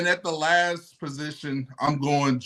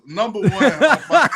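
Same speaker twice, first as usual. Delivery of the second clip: laughing; 6 semitones higher, 6 dB louder than usual. Real, not acted. Cracks up mid sentence.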